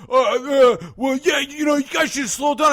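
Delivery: high, loud stammering comeback; mock a sputtering driver; hammed-up indignant stutter; flustered other driver.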